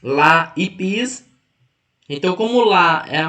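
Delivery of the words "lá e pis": "lá e pis" very slow, each syllable separate; teacher slowly counting out syllables, "lá e pis". Shown as a demonstration. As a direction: sound out the two parts of "lá e pis". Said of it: "também tem duas sílabas".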